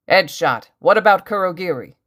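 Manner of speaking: loud, urgent question; yelling. A narrator's reading. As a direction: yell across to a comrade. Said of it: "the old hero yelled".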